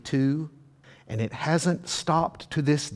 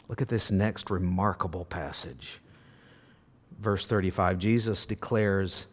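ordinary talk, nothing marked

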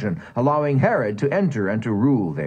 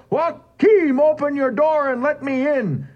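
loud, much higher demanding bellow; 3 dB louder than usual. A narrator's reading. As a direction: shout him to open up. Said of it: "he bellowed".

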